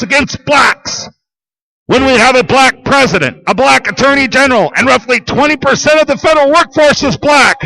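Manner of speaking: a fed-up voice yelling at the crowd; angry rant. Real, not acted. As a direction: shout your grievances. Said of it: "rant peak".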